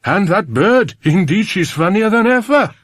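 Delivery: lilting Welsh farmer, chuckling; Welsh sing-song. Acted. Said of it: "in his up-and-down voice".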